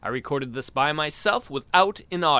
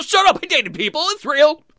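much higher and much louder defensive yell; indignant shout; 12 semitones higher, 8 dB louder than usual. Acted. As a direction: snap back, offended. Defensive outburst at imagined heckler.

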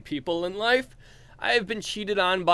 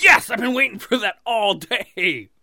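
mock eager voice; 6 semitones higher, 6 dB louder than usual. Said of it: "And you're like".

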